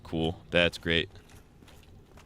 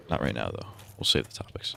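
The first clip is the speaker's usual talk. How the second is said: hushed aside, barely voiced; whispered aside to the stream; 8 semitones lower, 4 dB quieter than usual. Real, not acted. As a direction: drop to a secret whisper.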